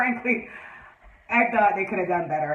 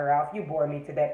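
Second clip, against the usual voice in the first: usual speaking voice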